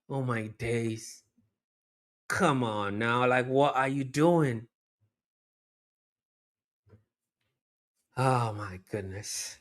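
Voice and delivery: slow, subdued dismay; deflated disbelief. Real, not acted. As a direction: let the air go out, stunned by the miss. Deflated after the wasted chance.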